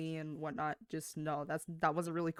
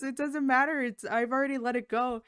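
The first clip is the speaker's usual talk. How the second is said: high, louder, fast disbelieving protest; pitched up, rushing in disbelief; incredulous retelling; 7 semitones higher, 6 dB louder than usual. Retold or imitated. Worker hearing the caller rang again, incredulous and high. Reacts to manager's news, exasperated.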